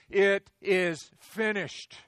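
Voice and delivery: very slow, loud, booming roar; drawn-out thundering proclamation; roared like a lion. Acted. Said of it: "he roared like a lion".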